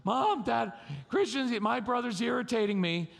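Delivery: put-on high-pitched kid complaining about a brother; whiny tattling sister. Daughter tattles to Mom and Dad about her brother, whiny. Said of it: "And she says".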